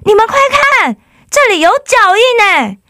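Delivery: high excited shout of discovery. Acted. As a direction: call everyone over, thrilled. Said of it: "罗拉大喊说". (兴奋地大喊)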